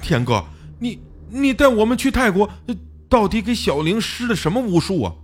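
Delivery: high, halting accusation; somewhat angry. A narrator's reading. Xiao Li phones his friend about witchcraft, indignant. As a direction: stammer into a heated demand. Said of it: "语调中透着几分愤怒".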